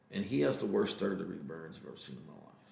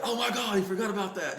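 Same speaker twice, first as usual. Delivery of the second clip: put-on much higher, thrilled kid voice; excited kid; 12 semitones higher, 3 dB louder than usual. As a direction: gush like a delighted boy. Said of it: "He's all excited".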